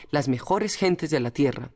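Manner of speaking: usual speaking voice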